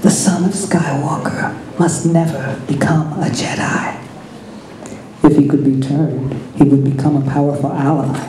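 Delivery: a hint of a sinister old emperor. A narrator's reading. Emperor's hologram speaks.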